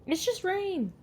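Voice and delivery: high-pitched coo; soothing. Real, not acted.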